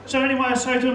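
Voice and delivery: ordinary delivery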